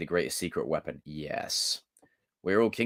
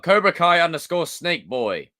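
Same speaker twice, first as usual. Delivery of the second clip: higher, announcer-like shout-out; 8 semitones higher, 4 dB louder than usual. Pretend. Streamer gives a viewer a shout-out, higher calling voice. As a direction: call the name out high and proud. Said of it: "Can I get a shout out?".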